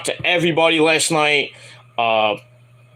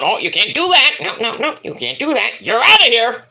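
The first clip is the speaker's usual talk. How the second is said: mocking the bosses; 5 semitones higher than usual. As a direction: mimic the bosses kicking her out. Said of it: "they find out".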